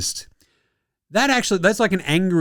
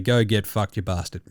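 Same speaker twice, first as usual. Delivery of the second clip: ordinary delivery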